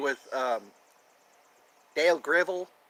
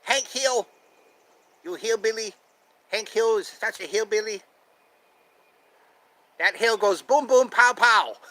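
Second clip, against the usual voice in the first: higher-pitched cartoon accent; Laotian neighbor impression; 6 semitones higher, 3 dB louder than usual. Laotian neighbor mocking the hillbilly, high impression. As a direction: taunt in a put-on cartoon voice. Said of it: "I do that guy from Laos".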